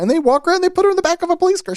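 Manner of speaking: high, fast, loud telling; high excited rush. Retold or imitated. Retelling girl's arrest.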